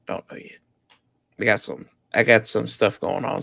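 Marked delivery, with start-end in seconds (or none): 0.1-0.6 whispered under breath; whispering. Real, not acted. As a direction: repeat it in a whisper. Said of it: "I don't know yet".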